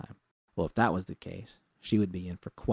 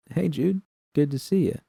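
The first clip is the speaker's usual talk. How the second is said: much higher, bright hello; cheerful greeting; 9 semitones higher, 6 dB louder than usual. A narrator's reading. Rita greets Jude at the entrance, cheerful. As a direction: greet her warmly and upbeat.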